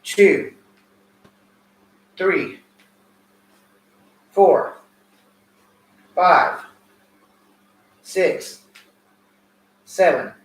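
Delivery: loud counting, each number punched; counting reps. Acted. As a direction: bark out each rep count. Coach counting workout reps.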